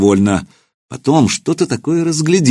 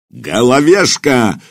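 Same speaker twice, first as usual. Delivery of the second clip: slow, drawn-out bellow of a name; angry commander bellows for his servant; roaring call; 3 semitones higher, slower than usual. Acted. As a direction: roar like a mad bull. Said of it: "бешеным туром взревел Кулиба".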